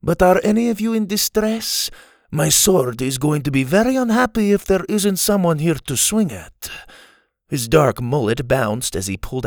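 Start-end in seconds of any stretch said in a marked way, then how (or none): 0.0-6.7 high, dashing Spanish swordsman; Spanish accent. A narrator's reading. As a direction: boast with Spanish flair. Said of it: "in his Spanish accent".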